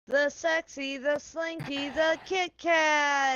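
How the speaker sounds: drawn-out ring announcer; announcer intro. Acted. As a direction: build each name up slowly. Wrestling-style player introduction.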